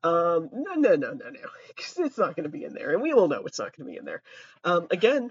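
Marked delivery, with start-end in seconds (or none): 0.5-4.2 quick throwaway mutter; fast aside. Real, not acted. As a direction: rush it under your breath.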